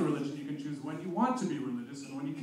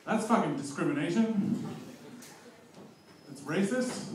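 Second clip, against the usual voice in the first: mock outrage; 3 semitones higher than usual. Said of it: "I just thought to myself".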